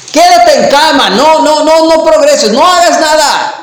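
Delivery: the lying voice of fear, urging you not to try; discouraging inner voice. Acted. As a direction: nag them to stay in bed, pressing harder. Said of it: "Y que te dicen".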